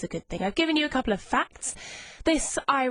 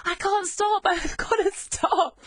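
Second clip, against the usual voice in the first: laughing and half crying, higher pitched; emotional laughter; 8 semitones higher, slower than usual. Real, not acted. Radio host overcome after playing her first-love song, laughing tearfully. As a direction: giggle through tears you can't hold back. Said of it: "I'm getting all emotional".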